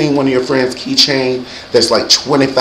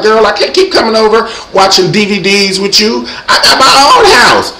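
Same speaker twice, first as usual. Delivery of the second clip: higher-pitched, exasperated comeback; exasperated retort; 7 semitones higher than usual. Retold or imitated. Tells friend off for asking company, exasperated high retort.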